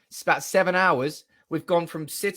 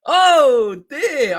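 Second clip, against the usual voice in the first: much higher and louder, drawn out; loud overwhelmed cry; 9 semitones higher, 10 dB louder than usual. Real, not acted. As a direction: cry out in joyful disbelief. Reacting to news again.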